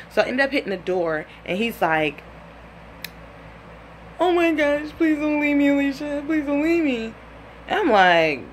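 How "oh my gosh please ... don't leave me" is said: high, whiny tearful begging; hammed-up blubbering plea; mock crying. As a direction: imitate a bawling man pleading like a baby. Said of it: "this man is crying".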